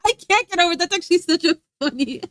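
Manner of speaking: can't get over the joke, laughing; real laughing. Real, not acted. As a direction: laugh while getting the words out. Cracking up at a chat message.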